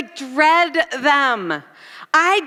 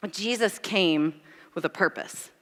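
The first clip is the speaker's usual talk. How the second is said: solemn preaching; 7 semitones lower, 7 dB quieter than usual. Acted. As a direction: bring it down for the message. Sermon turn to Jesus.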